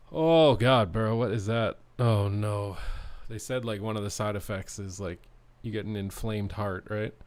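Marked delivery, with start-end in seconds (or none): 0.1-2.8 loud alarmed outburst; sudden alarm, louder. Real, not acted. Alarm at sudden chest pain.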